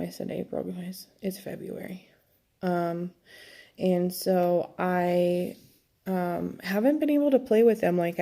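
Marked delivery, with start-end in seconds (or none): none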